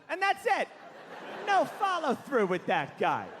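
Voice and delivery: ordinary talk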